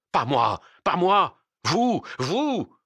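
loud, high cries of blame; panicked shouting. A narrator's reading. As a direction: shout to save your own skin. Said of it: "seule criait la voix de la conservation". (cris affolés d'accusation)

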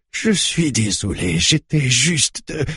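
high, distressed apology; anguished, stumbling excuse; pleading apology. A narrator's reading. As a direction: apologise desperately, pitch climbing until cut off. Old man apologises at gunpoint.

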